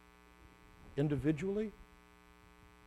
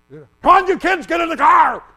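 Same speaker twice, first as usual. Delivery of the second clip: parent yelling; 11 semitones higher, 13 dB louder than usual. Retold or imitated. Parent hurrying kids to church.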